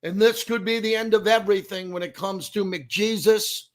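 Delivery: punchy, projected on-air host delivery, loud off the top; sports radio open. Real, not acted. Host opening live from Las Vegas between periods, punchy broadcast voice. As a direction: launch the show live with big, punched-up announcer energy.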